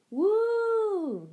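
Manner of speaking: high, loud playful ghost woo; mock-spook your friend; spooky ghost. Boy under a sheet playing ghost, spooky woo. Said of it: "he said in a spooky voice".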